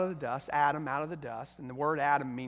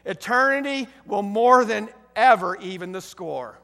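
full-force preaching; 4 semitones higher, 9 dB louder than usual. Acted. Preacher consoling the wounded soldier, booming preaching. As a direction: preach it out to the congregation.

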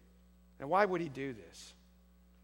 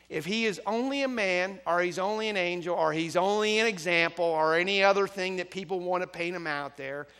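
raised, louder preaching voice; emphatic preaching; 4 semitones higher, 8 dB louder than usual. Real, not acted. Preacher arguing Christ is more than man, emphatic preaching. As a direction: drive each item of the list home. Preacher drives argument home.

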